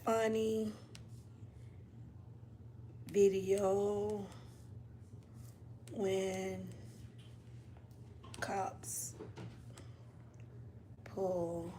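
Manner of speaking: very soft, muttering each word while typing; murmured to herself. Real, not acted. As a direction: mumble the search words under your breath.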